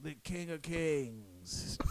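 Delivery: slow, hushed imitation; mock-solemn proclamation. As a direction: draw it out softly like a drunken pronouncement. Imitating friend quoting while punching.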